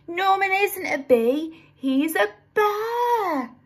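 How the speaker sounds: high, slow triumphant bee voice drawing out bear; triumphant reveal. A narrator's reading. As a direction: announce the proof slowly. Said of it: "said Amelia".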